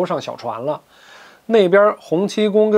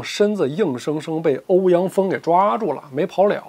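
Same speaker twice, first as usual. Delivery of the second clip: plain reading voice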